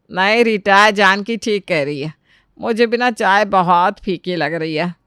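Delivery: lightly put-on elder's voice; mother-in-law taking sides. Mother-in-law telling Rita the tea is bland, matter-of-fact. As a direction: back her up as the mother-in-law.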